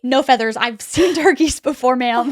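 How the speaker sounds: indignant caller. Retold or imitated. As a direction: lightly act out an exasperated caller. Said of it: "he was like".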